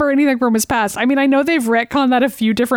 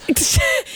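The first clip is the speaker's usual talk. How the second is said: a sudden squeal of a laugh; high burst of laughter; 3 dB quieter than usual. Real, not acted. Host cracking up at the dropped-dishes joke, high laughter.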